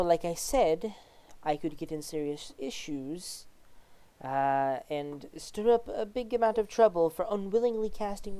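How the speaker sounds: lightly voiced judge, mostly hushed; a hint of a worried official, softly confiding; cautious judge. Judge warns she could lose her job, lightly voiced and cautious.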